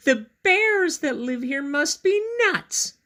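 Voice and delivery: put-on high, loud exasperated girl voice; high groaning girl; exhausted groan. A narrator's reading. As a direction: groan it out like a worn-out little girl. Goldilocks voiced in story.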